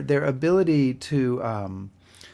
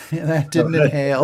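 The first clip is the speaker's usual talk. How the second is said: laughing; 3 semitones higher, 5 dB louder than usual. Real, not acted. Laughing at the veteran story.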